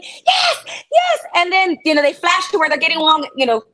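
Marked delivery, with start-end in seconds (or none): 0.0-1.2 high, shouted yes; shouted cheer. Retold or imitated. Fan jumping up at the hit, high excited shout. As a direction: yell it like a fan on her feet. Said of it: "so I'm jumping up going".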